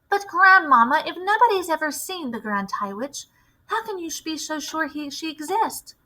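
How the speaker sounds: a hint of a doubtful small boy; young boy questioning. Boy questions his grandmother.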